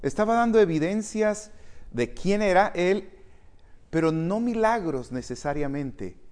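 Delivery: ordinary delivery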